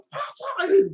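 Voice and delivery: very high cartoon duck gibberish; Donald Duck garbled squawk. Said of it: "he's trying to tell them".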